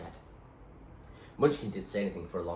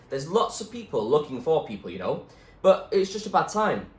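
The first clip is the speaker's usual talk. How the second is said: firm, louder doctor voice; doctor's voice; 9 semitones higher, 9 dB louder than usual. Acted. Doctor telling Zoe about the rescue ship, firm voice. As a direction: speak as the tired doctor.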